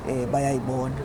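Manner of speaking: usual speaking voice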